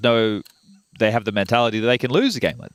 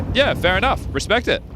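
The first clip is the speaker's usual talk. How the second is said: higher, accepting fan; a fan shrugging it off, higher; mock fan voice; 9 semitones higher than usual. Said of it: "It's like".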